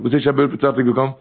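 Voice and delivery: ordinary delivery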